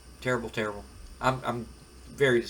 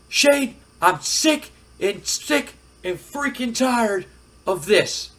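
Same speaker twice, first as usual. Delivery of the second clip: slow, emphatic, high-pitched exasperation; fed-up wrestler; 8 semitones higher, 5 dB louder, slower than usual. Acted. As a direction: hit each word hard, loud and fed up. Acted angry wrestler confronting his boss.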